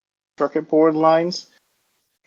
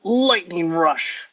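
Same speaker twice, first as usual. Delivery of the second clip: drawn-out, slightly raised battle call; mock battle shout; 4 semitones higher, slower than usual. Gage charging at the dragon, mild mock shout. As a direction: call out the attack name slowly, not truly shouting. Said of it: "Gage shouts".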